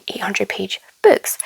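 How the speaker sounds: ordinary delivery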